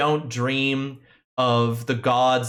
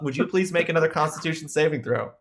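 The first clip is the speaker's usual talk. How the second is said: normal talking voice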